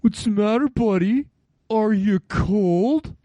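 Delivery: high taunting voice, slowing on cold; mocking coworker. Coworker teasing the bundled-up man, high mocking taunt. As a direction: needle him like a smug buddy. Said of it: "he goes".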